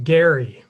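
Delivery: "Gary" said slowly with a hard G. Shown as a demonstration. Lecturer teaches audience a founding father's last name, hard G in "Gary". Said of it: "with a hard G".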